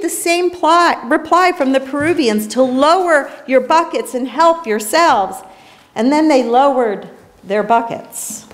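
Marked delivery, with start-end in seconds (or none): none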